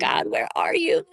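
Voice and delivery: breathy whispered plea to God; whispered prayer. Retold or imitated. Lonely prayer in grief.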